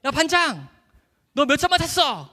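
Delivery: high, loud shout to a classmate; elated, high-pitched hollering; triumphant call. Retold or imitated. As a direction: call out cockily across the ballfield. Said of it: "너무 이제 막 성취감에".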